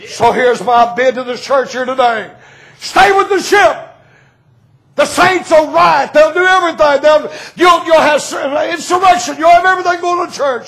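high, shouted revival preaching; preaching at full force. Acted. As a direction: cry it out to the congregation.

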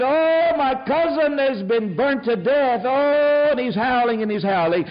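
imitating a grieving woman's anguished cry; mock grieving cry. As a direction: cry it out in grief. Grieving woman's reply retold.

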